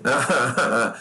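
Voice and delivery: short burst of laughter; amused laugh. Real, not acted. Teacher laughing at the exam's wrong words, amused. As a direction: laugh at the silly word.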